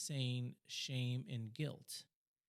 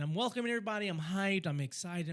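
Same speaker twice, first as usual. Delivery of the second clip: higher-pitched hyped-up welcome; excited; 6 semitones higher, 4 dB louder than usual. Retold or imitated. Telling of welcoming everybody on stage, excited. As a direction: retell the excited welcome with a lifted voice. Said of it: "I was really happy and excited".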